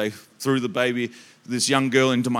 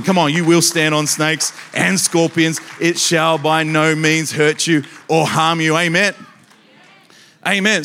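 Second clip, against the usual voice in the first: louder preaching with scripture building to amen; rising preacher declaration; 5 dB louder than usual. Acted. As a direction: proclaim it over the crowd, fired up.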